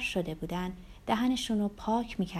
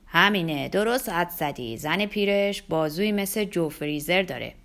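loud put-on male voice; boisterous joking man; 6 dB louder than usual. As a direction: crow it to the mates at the bar. Man jokes about Jerry's wife.

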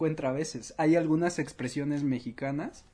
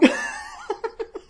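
high squealing laugh; 4 dB louder than usual. Real, not acted.

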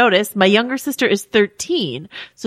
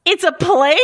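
surprised yell; 10 semitones higher, slower than usual. Retold or imitated. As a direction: call it out amazed at the plane. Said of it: "She yelled out".

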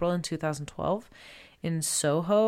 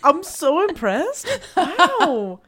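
high, loud and amazed; impressed; 12 semitones higher, 9 dB louder, slower than usual. Real, not acted. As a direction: gasp out the praise. Impressed reaction.

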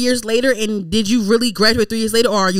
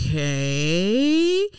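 long drawn-out okay, sliding up at the end; skeptical, dragged-out rising okay; annoyed disbelief; 3 semitones lower than usual. Retold or imitated. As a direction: stretch it slowly into a doubtful question. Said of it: "I was annoyed".

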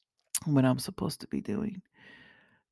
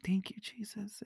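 whisper; 3 semitones higher, 8 dB quieter than usual. Retold or imitated. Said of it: "I was like".

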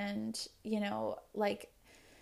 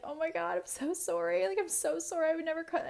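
higher-pitched, over-the-top sorry; imitating own apology; 7 semitones higher than usual. She apologizes to boyfriend over wraps, higher mock panic. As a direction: replay yourself apologizing in a rush.

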